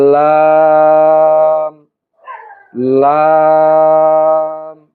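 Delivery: লাম with tongue tip on upper gum, long level aa; tongue-tip l sound. Shown as a demonstration. Teacher demonstrating the letter from the gum, লাম held long. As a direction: draw out each লাম steadily, closing on m. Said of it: "আমরা উচ্চারণ করি".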